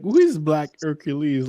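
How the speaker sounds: ordinary delivery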